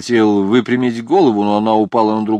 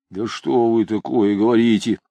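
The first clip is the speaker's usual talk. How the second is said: ordinary delivery, nothing marked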